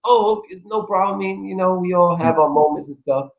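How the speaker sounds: mock easy forgiveness. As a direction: brush it off lightly. Forgiving reply, acted.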